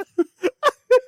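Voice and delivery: shrieking rising laugh; real helpless laugh; high laugh. Real, not acted. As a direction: squeal of laughter. Laughing hard.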